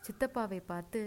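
ordinary delivery

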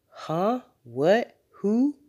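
high, rising one-word questions; acted bafflement, each word leaping up; puzzled father caught off guard; startled questions. A narrator's reading. Father startled by dog idea.

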